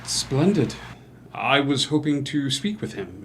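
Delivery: ordinary talk, nothing marked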